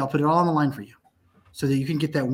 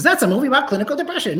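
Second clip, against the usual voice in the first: mock viewer; 8 semitones higher, 4 dB louder, faster than usual. Mock quote of audience.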